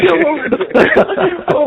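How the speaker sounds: laughing through the words, slow and high. Real, not acted. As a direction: crack up while asking. Caller laughing at the battle.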